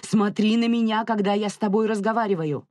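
high-pitched demand to be obeyed; angry growl. A narrator's reading. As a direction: growl it as a furious parental order. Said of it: "рычала Летиция".

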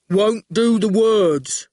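slow, dull oaf; goon voice. A narrator's reading. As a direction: plod through it like a simpleton. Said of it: "said the goon".

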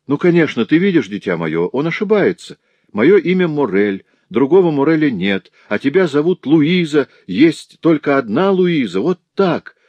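high, loud, feverish reasoning; frantic insistence. Acted. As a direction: convince them desperately, voice pushed right up. Father insists it is a mistake. (лихорадочно, отчаянно убеждая)

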